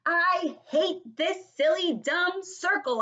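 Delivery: high, slow, loud complaint; drawn-out indignant kid yell; frustrated holler. Acted. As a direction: holler each word in frustration. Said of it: "I hollered".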